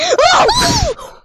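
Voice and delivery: sudden high loud yelp; scared horror game streamer. Real, not acted. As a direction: jump out of your skin. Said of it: "That jump scared me".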